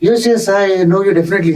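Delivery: high-pitched imitation of a famous cricketer; Sachin. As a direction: put on his high little voice, agreeing. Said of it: "Sachin is saying".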